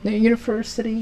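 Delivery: mock-elegant British accent; classy British. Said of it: "lebih berkelas".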